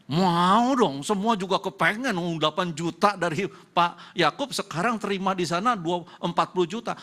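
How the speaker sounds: loud, high, playful voice of the crowd; eager mock answer. Preacher voices listeners wanting the bigger salary, loud and eager. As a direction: ham up how greedy everyone would be. Voicing everyone wanting the raise.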